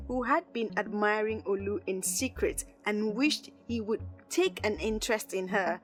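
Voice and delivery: ordinary delivery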